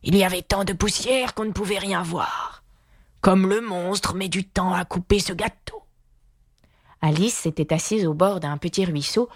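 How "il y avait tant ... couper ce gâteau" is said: higher, strained beast voice; grumbling lion. A narrator's reading. Lion impatient with Alice over cake, grumbling. Lion's voice in narrated story.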